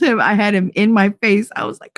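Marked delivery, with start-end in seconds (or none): none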